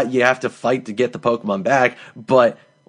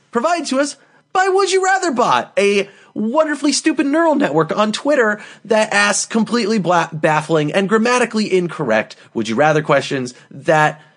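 high-pitched, gushing mock sponsor read; hammed-up announcer; 9 semitones higher than usual. Host introducing the question bot, hammed-up announcer. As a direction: sell it high, like an over-the-top announcer.

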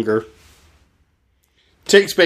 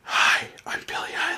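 breathy whispered celebrity impression; [name] whisper; 9 dB quieter than usual. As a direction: whisper it like a pop star. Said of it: "what is this voice?".

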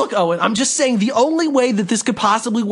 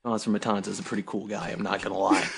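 deep voice; 14 semitones lower, 10 dB quieter, faster than usual. Acted. Deep aside voice.

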